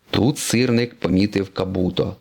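ordinary delivery

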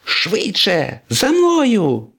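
urgent shout. Acted. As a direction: urge them on at the top of your register. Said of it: "крикнув Блискавка". (квапливий вигук високим голосом)

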